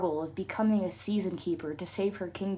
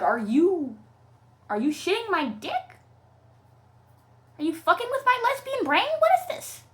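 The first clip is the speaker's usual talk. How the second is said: outraged yell; 9 semitones higher, 5 dB louder than usual. Real, not acted. Ranting at the book.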